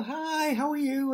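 mother's fake cheer. Mother greeting company, high put-on cheerful voice. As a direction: chirp it like a hostess hiding her nerves. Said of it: "you'd see a smile on her face".

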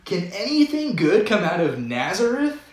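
high, loud scoffing retort; sarcastic retort. Retold or imitated. Nathanael scoffing at Jesus's hometown, loud sarcastic retort. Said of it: "more sarcastic ones of the bunch".

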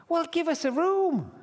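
high, exasperated yell; shouted demand. Acted. Joseph demanding a room from the innkeeper, yelling.

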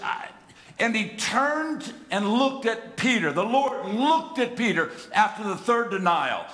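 high, loud impassioned preaching; fervent preacher pouring it out; preaching at full force. Real, not acted. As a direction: build the sermon to a passionate peak.

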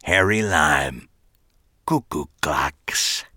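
slow, low, savoring each word; words rolling off tongue. A narrator's reading. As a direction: roll the name off the tongue slowly. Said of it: "The words rolled off his tongue".

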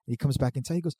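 normal talking voice

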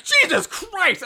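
high, drawn-out cry of imagined shock; hammed-up horrified exclamation; mock horror. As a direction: stretch it out in pretend alarm. Acts out own horrified reaction.

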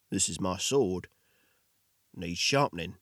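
low, terse swordsman; gruff hero voice. Acted. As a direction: keep it short and low, a man of few words. The hero Victor speaks.

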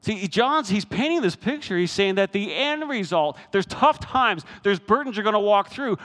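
high, loud, driving sermon voice; full-force preaching. Acted. Preacher firing up the congregation about joy. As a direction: preach it out to the back row.

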